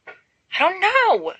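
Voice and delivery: high, drawn-out irritated retort; irritably. Acted. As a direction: let the annoyance drag the words. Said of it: "Narcissa says irritably".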